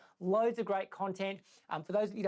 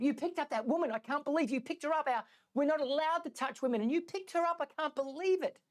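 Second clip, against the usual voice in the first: high-pitched, incredulous accusation; acted indignation; 6 semitones higher than usual. Young monk confronting his elder for carrying the woman, acted indignation. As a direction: protest in disbelief, pitched well up.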